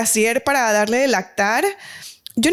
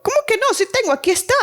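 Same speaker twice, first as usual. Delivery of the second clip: much higher, offended protest; indignant disbelief; 11 semitones higher, faster than usual. Retold or imitated. Teen mother protesting to the nurse, indignant. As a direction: refuse to believe it, defending yourself.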